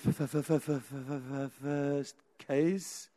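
soft, low, drawn-out stutter; nervous stammer. Acted. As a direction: get badly stuck on the first sound, dreading it. Acted exhausted, shaken judge stammering.

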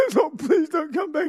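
high mock-sobbing plea; crying. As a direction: beg tearfully, hammed-up crying. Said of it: "He starts crying".

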